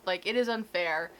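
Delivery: ordinary talk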